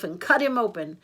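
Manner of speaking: plain reading voice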